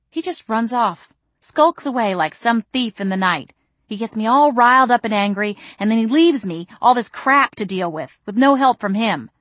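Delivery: riled-up complaint; angry venting. Acted. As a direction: vent your frustration to a friend. Angry rant about the man.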